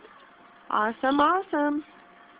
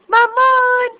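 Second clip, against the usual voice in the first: excited high call; 7 semitones higher, 10 dB louder than usual. Real, not acted. Greeting the baby loudly.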